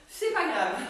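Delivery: ordinary delivery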